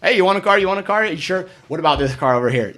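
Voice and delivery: pushy salesman. Retold or imitated. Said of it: "if you're gonna be pushy".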